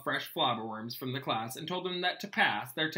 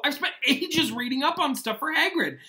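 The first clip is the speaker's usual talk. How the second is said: high, loud anguished howl; a loud, high cry of outrage; 12 semitones higher, 7 dB louder than usual. Acted. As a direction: wail it in protest. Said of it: "Ron howled".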